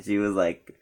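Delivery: ordinary delivery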